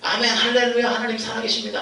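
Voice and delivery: imitating a fervent crowd; excited congregation. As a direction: cry out like fervent churchgoers. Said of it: "막 이렇게 선포를 했다는".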